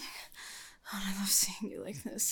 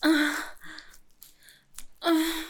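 high, loud pleasure moans; 7 semitones higher, 9 dB louder than usual. Acted. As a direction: cry out near the climax. Climactic moaning.

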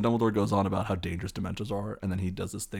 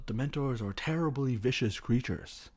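Dumbledore; 5 semitones higher than usual. Retold or imitated. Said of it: "Dumbledore goes".

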